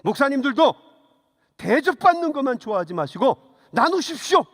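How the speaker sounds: loud, sharp retold admonition; scolding the pastors. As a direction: call out the pastors like a fiery elder. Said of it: "성질이 있으니까 우리 목사들에게도".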